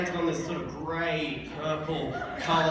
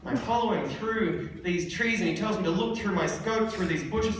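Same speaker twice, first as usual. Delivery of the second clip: ordinary delivery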